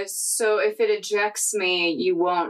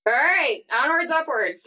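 confident rallying cry, higher pitch; more confidence; 6 semitones higher than usual. Acted. Player rallies the party after coaching, confident call. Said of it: "you gotta say it with more confidence".

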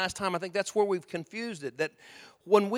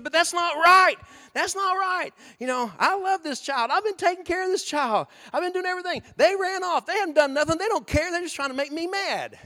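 much higher, loud aggrieved pleading; upset protest; 11 semitones higher, 5 dB louder than usual. Retold or imitated. Parent pleading with the officer, high and loud. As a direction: plead your case, hurt and indignant. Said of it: "you said".

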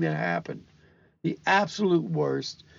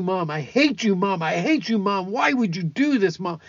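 raised, higher, hard-stressed imitation of a yelling child; screaming in anger; 6 semitones higher, 5 dB louder than usual. Grandfather retells grandson yelling at his mom while hammering nails, imitated angry yell. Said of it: "the whole time he's screaming, and he's yelling".

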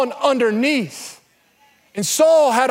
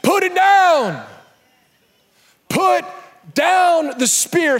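commanding shout; 5 semitones higher, slower than usual. Real, not acted. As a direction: order them to drop it. Preacher commanding.

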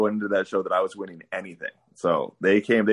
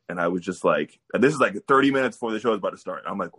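ordinary talk, nothing marked

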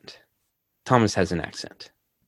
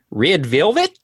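higher-pitched attempt at Thomas's accent, rising like a question; badly faked foreign accent, pitched up; 8 semitones higher, 4 dB louder, slower than usual. Shown as a demonstration. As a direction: try out a key phrase in a put-on accent. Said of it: "Thomas's accent".

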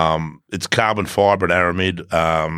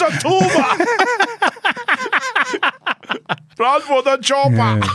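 high, strained mock Austrian yell; hammed-up action-hero accent through laughter; Schwarzenegger impression. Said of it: "Now you're Arnold Schwarzenegger".

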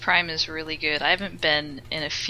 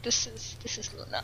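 retold whisper; 5 semitones higher, 11 dB quieter than usual. Retelling friend's whispered introduction.

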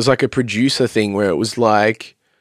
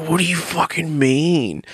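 baffled disbelief; 4 semitones higher, 4 dB quieter, slower than usual. Retold or imitated. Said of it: "I was like".

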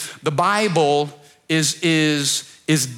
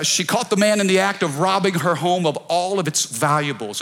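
ordinary delivery

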